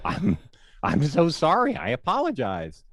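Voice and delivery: high, flustered apology in the reporter's voice; Tim Kurkjian impression. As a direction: play him caught off guard, sorry. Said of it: "And he was like".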